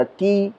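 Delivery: की said softly and short, no अ sound after क; soft short demo of की. Shown as a demonstration. Teacher demonstrates की for students, soft and clipped. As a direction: say की quickly with only the ee vowel. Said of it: "की बोलेंगे तो उसके पीछे अ का उच्चारण नहीं".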